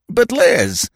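plaintive protest. Acted. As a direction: whine it a little. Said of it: "said the tree plaintively".

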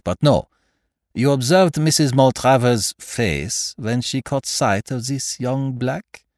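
put-on methodical detective in a French-style accent; Poirot, foreign-accented detective. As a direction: reason it out aloud with a foreign accent. Voices Poirot in dialogue.

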